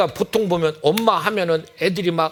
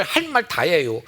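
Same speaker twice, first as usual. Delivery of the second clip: usual speaking voice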